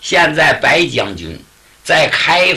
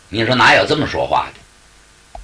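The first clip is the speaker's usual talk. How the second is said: ordinary delivery, nothing marked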